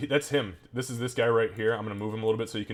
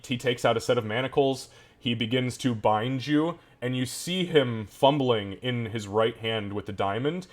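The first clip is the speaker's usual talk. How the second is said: ordinary talk, nothing marked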